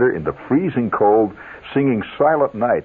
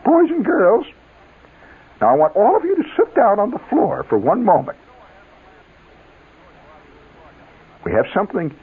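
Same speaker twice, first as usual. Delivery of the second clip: prim music teacher; 9 semitones higher than usual. Retold or imitated. Music teacher hushing the glee club, in a high prissy voice. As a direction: play the fussy teacher calling the kids to order, high-pitched. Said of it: "She says".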